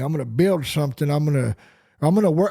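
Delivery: lightly voiced beer-drinking guy; man voice. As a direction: play the garage guy bragging. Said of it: "it's the man voice".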